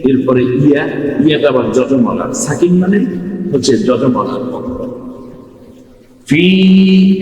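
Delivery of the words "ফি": "ফি" held long on a raised, steady note; one-alif elongation. Shown as a demonstration. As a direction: draw out the ii in "ফি". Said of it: "একালিপ টেনে".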